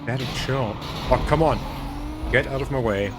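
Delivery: usual speaking voice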